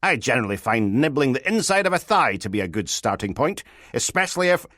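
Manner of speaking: high, loud, lewd advice; sleazy detective. Acted. Detective gives sergeant unwanted bedroom advice, sleazy lecturing. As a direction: hold forth with smutty relish.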